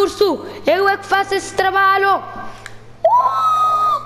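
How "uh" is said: sudden shrill yelp; high yelp. Real, not acted. Yells while attacking bear.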